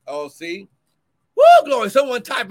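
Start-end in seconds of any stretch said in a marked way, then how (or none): 1.4-1.9 high, loud whooping praise; joyful church whoop; ecstatic praise shout. Real, not acted. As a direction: let the glory burst out.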